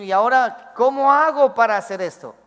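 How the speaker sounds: high, loud cry of a lost man; voicing David, loud and helpless; despairing David. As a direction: plead like someone at a loss.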